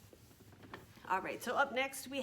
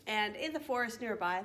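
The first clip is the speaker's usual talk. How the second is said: ordinary delivery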